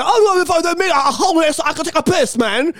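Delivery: put-on high, sing-song Australian accent; hammed-up Australian bloke. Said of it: "I can't do Australian accent at all".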